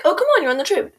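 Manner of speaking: mock the nice way she didn't say it; sing-song "come on", pitched well up; cheerful version. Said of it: "she wasn't like".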